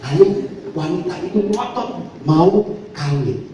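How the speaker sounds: usual speaking voice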